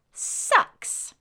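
put-on rude child.

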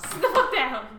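angry yell. Acted. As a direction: bark it at them. Repeats the quarrel line.

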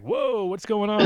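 high-pitched imitation of a startled officer; startled checkpoint guy. Officer to man jumping out of car, high startled voice. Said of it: "he's all like".

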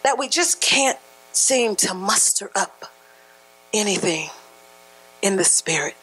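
normal talking voice

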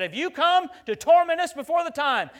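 high, loud demon shriek; mock-terrified devil shouting out; devil's cry. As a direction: wail it like a cornered devil. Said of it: "the devil said".

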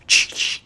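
voiceless imitated shotgun racking, ch ch; click-clack a pump shotgun with your mouth; shotgun pump noise. Retelling the mom cocking her shotgun at the aliens, imitated pump click. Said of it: "the universal "shut your fucking mouth" noise".

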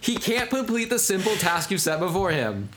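high, slowed-down scolding; mock exasperation. Co-host acts guard scolding the soldiers, mock exasperated.

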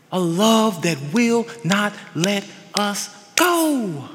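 normal talking voice

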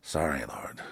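hushed, breathy apology to God; soft whispered aside to the Lord; whispered prayer. Real, not acted. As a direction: whisper it under your breath. Aside after admitting anger.

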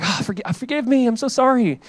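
lightly hammed-up plea for forgiveness; mock apology.

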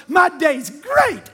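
put-on drawn-out, emphatic little-kid delivery; mimicking a grandchild's slow, gleeful answer; imitating little grandson. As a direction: stretch GREAT way out like an excited small child. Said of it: "That's exactly the way he said it".

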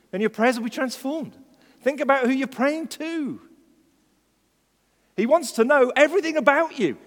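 high, loud preaching that keeps building; impassioned preaching. Acted. Preacher urging congregation to think about the King, fervent preaching. Preaching at rising full force.

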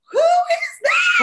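high, stretched-out mock shriek; loud wail of exaggerated outrage; outraged disbelief. Daughter reacts to mom calling her Katie, outraged shriek. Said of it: "And I was like".

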